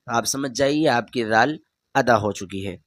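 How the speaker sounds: normal talking voice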